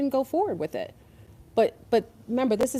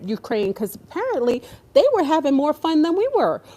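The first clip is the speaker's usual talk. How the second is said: usual speaking voice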